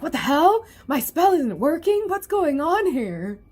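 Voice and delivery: high, baffled outcry; confused dismay. Retold or imitated. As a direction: voice a villain thrown off balance. Snake Man's spell fails.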